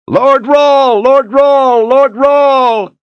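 high, loud battle chant; roaring shouts. Acted. Thousands of soldiers cheer amid battle, shouted chant. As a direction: shout the name over and over. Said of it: "the roar of the shouts".

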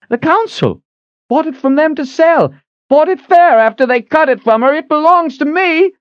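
high, strained voice of a cornered hawker; frightened hawker. A narrator's reading. Hawker pinned against the wall protests, high and loud. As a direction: plead high and loud, caught.

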